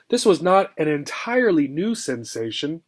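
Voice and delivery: usual speaking voice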